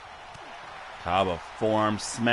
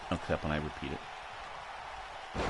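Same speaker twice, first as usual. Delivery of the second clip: unvoiced, soft aside; whispering; 10 semitones lower, 15 dB quieter, slower than usual. Real, not acted. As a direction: whisper it to yourself. Muttered aside.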